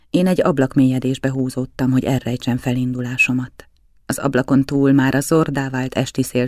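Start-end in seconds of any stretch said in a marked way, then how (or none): none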